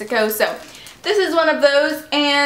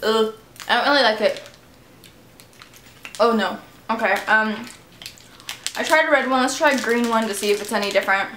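grimacing with disgust; talking while chewing candy; 2 semitones lower, faster than usual. Real, not acted. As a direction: mouth partly full. Seen 3: Candy in mouth after -7; mouth working, grimacing through the words.

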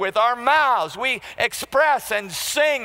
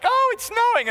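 much higher mock wife voice; imitating wife. Preacher imitating his wife spotting snow, high and cheerful. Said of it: "my wife looks out the window, and she's like".

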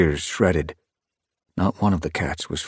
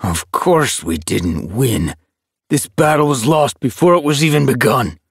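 contemptuous tom; 8 semitones higher, 5 dB louder than usual. A narrator's reading. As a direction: spit out the defeat with a curled lip. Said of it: "his lip curling in contempt".

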